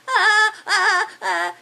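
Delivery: high, loud mock-panicked "ah" cries; short acted yelps of alarm. Fairy sees a tree start to fall behind him, panicked yelps.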